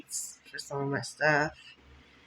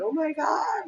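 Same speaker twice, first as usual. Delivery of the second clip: shocked gasp; 12 semitones higher than usual. Retold or imitated. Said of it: "I was like".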